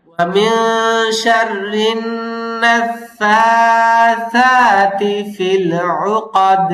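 slow, drawn-out melodic chant; slow tajweed chanting; Quran recitation. Shown as a demonstration. Recites the verse.

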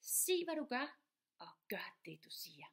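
emphatic key phrase; emphatic motto. Shown as a demonstration. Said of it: "du får det en sidste gang".

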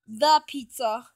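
ordinary delivery, nothing marked

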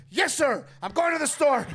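high, loud eager-to-please staffer; eager runner. Retold or imitated. Comedian mimics the overeager runner, loud and high. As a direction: bark it out like a keen runner.

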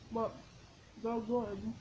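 speaking between bites; chewing while talking. Real, not acted. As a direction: mouth partly full of snack. Seen 0: Snack put in mouth at -1; jaw and cheeks chewing while speaking.

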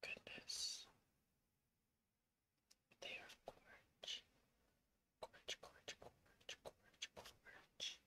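soft unvoiced whisper while painting; breathy ASMR-like whisper to the camera. Real, not acted. Painting, whispering throughout start.

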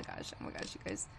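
whispering. Real, not acted. Nervous gamer in scary area.

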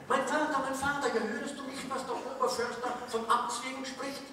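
put-on high, pleading child-like tree voice; mock-dramatic Erlking child; frightened fir tree.

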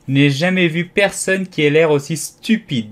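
high, loud, sudden insult; abrupt outburst. A narrator's reading. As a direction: blurt it out scornfully. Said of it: "si brusquement qu'Alice fut un véritable bond".

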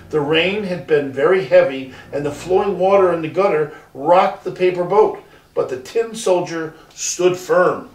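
usual speaking voice

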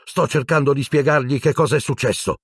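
high-pitched, heated retort; furious. A narrator's reading. Harry answering back to the nurse, furious. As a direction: fire back in exasperation. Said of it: "ribatté Harry furibondo".

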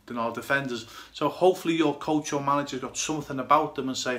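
normal talking voice

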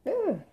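high groan of disgust. Real, not acted. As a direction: groan at the sour taste. Reacting to sour lemon.

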